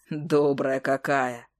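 low, very slow irony; deep, drawn-out teasing; teasing hunter. A narrator's reading. Hunter teases the witch.

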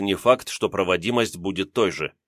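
usual speaking voice

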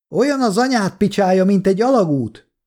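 high-pitched yelled insult; shouting. A narrator's reading. Kolja hurls an insult at doubting soldiers, shouting. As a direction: fling the taunt out of the window. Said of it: "kiabálta".

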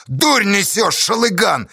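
high, dismissive rebuke; scolding shout. A narrator's reading. Kornila rebuking the loudmouth, scolding shout. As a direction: tell him off loudly. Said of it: "добавил Корнила".

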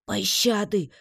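high, strained plea; worn-out, high-pitched croak for mercy; hoarse gasp. A narrator's reading. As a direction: rasp out a plea from exhaustion. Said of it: "прохрипела я".

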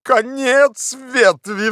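high, drawn-out cry; thrilled, stretched high shout; excited exclamation. A narrator's reading. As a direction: cry out at the sudden news. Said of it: "вскричал Легран".

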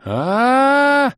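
long, high, loud scream; anguished wail. A narrator's reading. Two friends watch the wine smash, wailing. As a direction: wail in horror at the loss. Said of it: "Вопль Бома и злобы".

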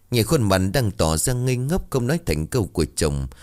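plain reading voice

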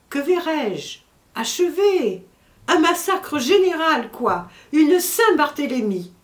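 outraged. Acted. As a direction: cry out at the massacre. Servant cries out about the massacre. (exclamations indignées, plus aiguës et plus fortes)

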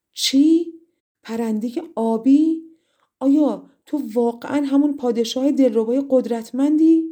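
high, loud astonished questions. A narrator's reading. Princess learning the bird is her king, astonished outcry. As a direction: burst out in disbelief.

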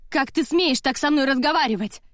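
high, loud, outraged demand; angry yell. A narrator's reading. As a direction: yell it as an affronted challenge. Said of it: "закричала я на Мелиссу".

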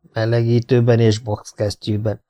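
usual speaking voice

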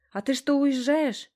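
high, slow anxious question; alarmed. A narrator's reading. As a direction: ask it in dismay at being left. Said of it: "испугалась она".

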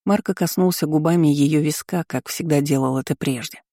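usual speaking voice

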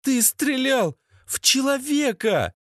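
high-pitched, scandalised accusation; aghast woman. Acted. Lena confronts Sasha over a shooting, high and aghast. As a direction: pitch it way up, appalled.

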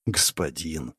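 soft, quiet delighted greeting; smiling murmur. Acted. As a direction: breathe the word with a smile. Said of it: "улыбнулся Рандал".

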